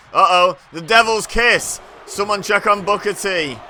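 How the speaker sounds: loud, rising alarm at the big move; excited call. Real, not acted.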